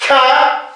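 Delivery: "ka" with a puff of air after the k; aspirated consonant. Shown as a demonstration. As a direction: breathe out through the k of "ka". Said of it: "consonantes aspiradas". (consonante aspirada, "ka" con soplo)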